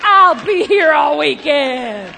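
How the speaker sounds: loud, slow showman's sign-off; milk it like a club comic, loudly; mock comedian sign-off. Mock comedian sign-off after joke.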